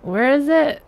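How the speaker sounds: frantic looking; confused search. Real, not acted. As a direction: ask it baffled and loud. Searching for the missing book.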